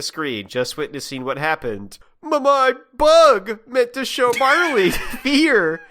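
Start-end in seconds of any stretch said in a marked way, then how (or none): 2.2-5.8 high, slow, loud hammed-up rage; mock rage scream. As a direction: scream it like a frustrated villain. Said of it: "Diz screamed in rage".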